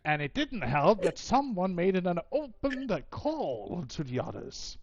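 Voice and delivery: high, loud, swooping complaint; annoyed goddess venting, pitch leaping up; exasperated scolding. Acted. Goddess scolds a mortal for calling.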